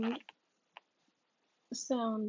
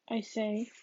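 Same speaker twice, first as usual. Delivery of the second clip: ordinary delivery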